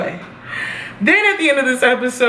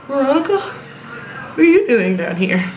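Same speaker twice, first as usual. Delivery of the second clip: lightly voiced Kevin; 3 semitones higher than usual. Quoting Kevin in his kitchen.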